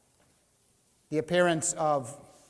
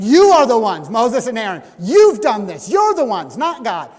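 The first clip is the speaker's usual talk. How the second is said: loud, higher accusing shout; angry accusation; 5 semitones higher, 14 dB louder than usual. Retold or imitated. Crowd shouting blame at Moses and Aaron, angry. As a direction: hurl the blame at them. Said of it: "They're like saying".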